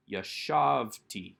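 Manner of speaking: yashavti drawn out very slowly with stress on shav. Shown as a demonstration. Teacher slowly sounding out yashavti for students. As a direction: stretch yashavti, leaning on the middle syllable shav. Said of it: "it stays on that second to last syllable".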